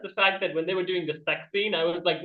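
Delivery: ordinary delivery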